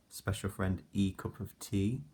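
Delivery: ordinary delivery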